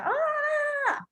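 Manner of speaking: high, held theatrical cry; over-the-top in-character "aaah"; dramatic "ah". Acted. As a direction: hold one long high note, hammed-up. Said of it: "get into character like crazy".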